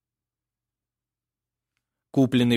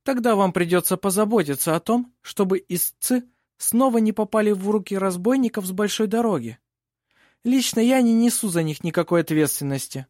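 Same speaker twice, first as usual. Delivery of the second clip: higher-pitched, disdainful lawyer; sour-smiling; 8 semitones higher than usual. A narrator's reading. Opposing lawyer answers the warning, sourly. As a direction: disclaim responsibility with a sour smile. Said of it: "Сёренсен кисло улыбнулся".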